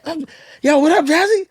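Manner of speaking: high, excited pickup; eager phone greeting. Retold or imitated. Answering the producer's late-night call, eager high greeting.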